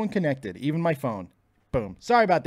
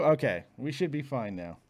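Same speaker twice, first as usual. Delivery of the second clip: ordinary talk, nothing marked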